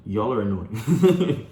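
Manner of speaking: loud, drawn-out complaint; playful exasperation. Real, not acted. Telling the callers they're a nuisance, playfully exasperated. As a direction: stretch it out loudly, on the edge of a laugh. Teasing complaint to viewers, then laughs.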